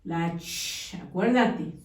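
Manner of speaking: normal talking voice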